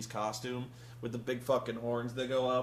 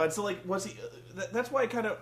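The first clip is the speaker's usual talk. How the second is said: ordinary talk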